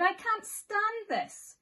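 slow, high, loud wail of despair; slow exasperated groan of a tired dad bear; exasperated Mister Bear. Acted. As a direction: drag it out like a bear at the end of his rope. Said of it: "said Mister Bear".

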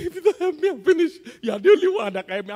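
high, loud mock begging; desperate pleading. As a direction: whine like someone helpless and desperate.

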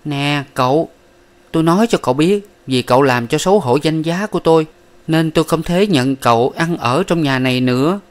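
Miss Ba angrily throws him out; angry. A narrator's reading. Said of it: "coi tuồng giận dữ mà rằng".

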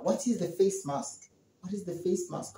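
soft inner voice, words half under the breath; thinking aloud softly. Shown as a demonstration. Student puzzles over the question, thinking quietly to self. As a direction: murmur it to yourself while puzzling. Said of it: "You're gonna think now".

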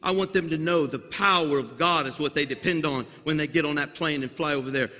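ordinary talk, nothing marked

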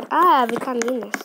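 ordinary delivery

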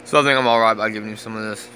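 ordinary talk, nothing marked

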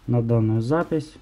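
ordinary talk, nothing marked

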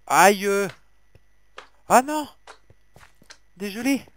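sudden high yelp of pain, then a shocked cry; real shock, loud and high; startled yelp. Real, not acted. Hit by a mob while mining. (cri de surprise aigu et fort)

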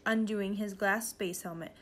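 plain reading voice

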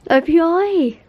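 high, loud excited reveal; eager high call of his name. Real, not acted. Anniversary gift reveal, calling him.

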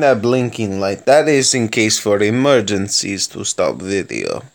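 hammed-up mock Russian accent held through the commentary. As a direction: play a cheerful Soviet tank commander with a thick Russian accent. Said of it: "I like doing that accent".

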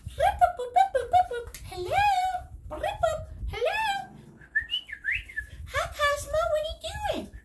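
high phone beeps and hello; pretend phone. Parent pretending to take a phone call for the kids, high voice. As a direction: chirp the ring then answer brightly.

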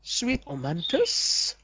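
normal talking voice

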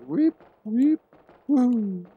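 high, playful squeaky sound effects; imitated weep-weep-woo noise. Gamer mimicking a guy following him, playful high noises. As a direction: toss out high mock noises.

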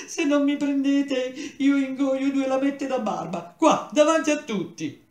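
high, loud, tearful wail; crying scream. Acted. Pale boy rejected at audition, screaming through tears. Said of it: "urlò".

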